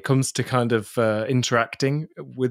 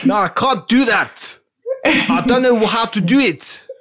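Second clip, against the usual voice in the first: South African accent attempt; 9 semitones higher, 4 dB louder than usual. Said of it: "please speak in a South African accent".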